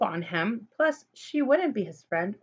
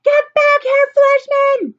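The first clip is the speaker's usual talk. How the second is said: shrill, very loud scream from far higher up; 13 dB louder, slower than usual. Acted. Lulu screaming at Fleshman while tied to a tree. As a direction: scream it with all you've got. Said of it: "still screaming".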